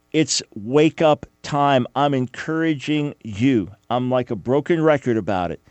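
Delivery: normal talking voice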